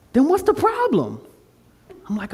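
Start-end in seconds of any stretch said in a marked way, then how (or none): 0.1-1.2 high-pitched, puzzled challenge; challenging. Retold or imitated. Friend questions his hesitation about music, challenging. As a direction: press him as if there is no obstacle.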